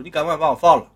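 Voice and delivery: shouted order to let him go; loud demand. Acted. Captured boy shouting at Liu Yong to let him go. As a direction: bark it out, defiant.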